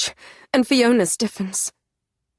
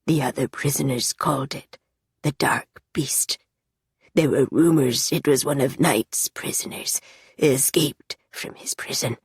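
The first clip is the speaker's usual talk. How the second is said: haunted whisper; 4 semitones lower than usual. Acted. Haunted low voice.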